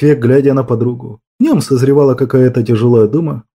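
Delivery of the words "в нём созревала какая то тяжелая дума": ordinary delivery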